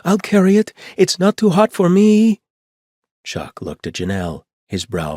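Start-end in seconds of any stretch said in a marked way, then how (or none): 0.0-2.4 put-on very high, loud child's voice; a little kid desperate to be chosen; eager little girl. A narrator's reading. Rosie pleading with Chuck for the hatchet, high loud child.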